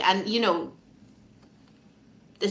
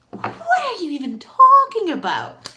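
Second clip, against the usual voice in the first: high, swooping mock disbelief; incredulous; 6 semitones higher, 4 dB louder than usual. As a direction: scoff at the doubter, stretching TALKING. Acted disbelief at doubters.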